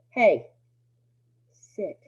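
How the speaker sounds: calm firm command. Shown as a demonstration. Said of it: "if you say things calmly, firmly, and clearly".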